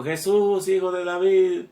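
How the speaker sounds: mucho más alto y fuerte, suplicando; gritando al Señor. Retold or imitated. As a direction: clama con fuerza como el ciego. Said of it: "gritó". (el ciego de Jericó le grita a Jesús, suplicando a voz en cuello)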